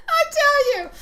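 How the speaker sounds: high, loud, laughing through the words; cracking up as she speaks. Real, not acted. Telling viewers about her mom's funny bird, laughing hard.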